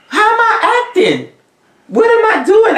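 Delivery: high, fast incredulous questions; indignant disbelief. Real, not acted. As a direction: pitch leaps up, quick with a laugh. Indignant retort with laugh.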